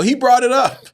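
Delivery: high, loud cheeky comeback; indignant retort. Retold or imitated. Arrested man retorts to the agent, high and cheeky.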